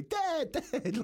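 high-pitched mock scream for Dad; imitated screaming daughter. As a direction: shriek like a panicked kid calling out. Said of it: "She's screaming at me".

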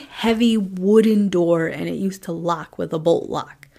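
ordinary talk

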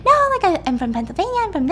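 high-pitched run-on babble; hammed-up high chatty voice; mock chatterbox. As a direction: imitate someone who won't stop talking. Mimics Ingrid's nonstop chatter at park.

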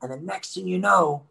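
usual speaking voice

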